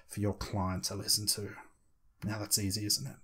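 slow, softened hypnotic delivery with long pauses; slow it right down and pause between phrases; measured, lulling hypnotist's demo. Shown as a demonstration. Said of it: "it starts to slow things down".

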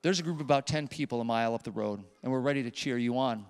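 soft, low, gentle encouragement; tender reassurance. Retold or imitated. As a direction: hush it down, kind and sincere. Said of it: "He said".

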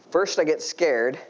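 normal talking voice

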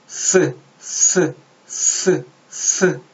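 스 as a lisped English th; th sound demonstration. Shown as a demonstration. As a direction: repeat 스 apart, tongue between teeth. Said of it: "이 정도로 발음하시면 되고요".

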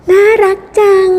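high-pitched little coo; adoring. Acted. Visitor admires the porcupine's little one, adoring.

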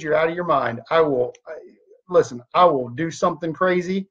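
ordinary talk, nothing marked

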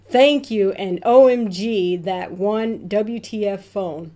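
ordinary talk

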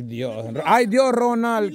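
ordinary talk

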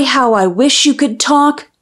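plain reading voice

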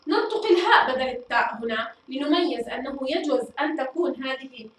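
usual speaking voice

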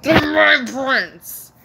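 slow, loud play-acting cry; big drawn-out pretend declaration; pretend proclamation. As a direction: draw it out loudly, as in a toy drama.

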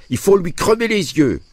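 put-on very high squealing little-boy shout; child's shrieked cry. A narrator's reading. Little Paul denouncing the peasant, shrieked. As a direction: cry it out like an outraged small child. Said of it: "Paul montra du doigt le paysan surpris et cria".

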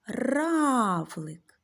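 Равлик, with a loud, stretched А; stretched teacher demo. Shown as a demonstration. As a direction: say Равлик loudly, leaning on the А. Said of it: "Звук А знаходиться посередині". (вчителька голосно виділяє А у слові Равлик)

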